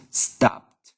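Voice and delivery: STOPPED in one syllable ending in "pt"; pronunciation demo. Shown as a demonstration. Teacher showing the past form, STOPPED pronounced with a final "t". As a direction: model STOPPED as "stopt". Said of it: "the pronunciation is".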